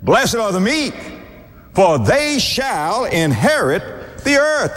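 high, slow "for they shall inherit", leaping up on "the earth"; rising dramatic recitation. Shown as a demonstration. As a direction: build the verse to a high peak. Preacher repeats verse, building.

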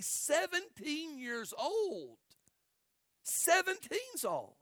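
slow, high, drawn-out disbelief; appalled emphasis. Real, not acted. Preacher appalled at what was done to a seventeen-year-old, appalled emphasis. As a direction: stretch the age out in a high, aching cry.

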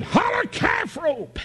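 put-on high, slow yell at the pinned boy; mock shout. Schoolboy on top in a fight, demanding surrender, shouting. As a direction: yell it out to make him give up.

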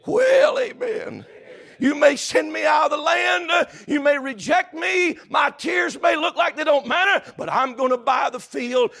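high, loud shouted preaching; preaching at full force. Acted. Preacher shouting to the congregation at full force. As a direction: lift the room with a ringing cry.

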